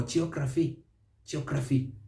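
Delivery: "geography" as choh-KRA-fee; local mispronunciation. Shown as a demonstration. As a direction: wrongly swallow the first syllables of "geography" into "cho". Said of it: "But here".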